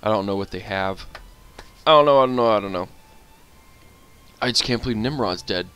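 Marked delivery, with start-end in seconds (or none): 1.9-2.5 louder, rushed fretting; louder nervous babble; flustered. Real, not acted. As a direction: fret out loud, rattled. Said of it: "flustered repetition".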